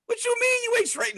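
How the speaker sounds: a high, disbelieving question; incredulous. Retold or imitated. To his older sister in trading, high mock disbelief. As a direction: squeak it like you can't believe it. Doubting her claim of struggling.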